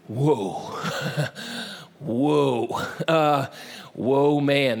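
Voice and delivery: low, drawn-out mock awe; comically smitten, lost for words; hammed-up low "whoa" with a laugh; awestruck. Acted Adam first seeing the woman.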